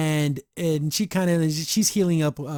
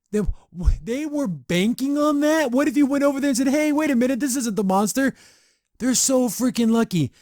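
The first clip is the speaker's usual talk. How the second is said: stunned disbelief; 10 semitones higher, 4 dB louder than usual. Real, not acted. Disbelief at the show's plot.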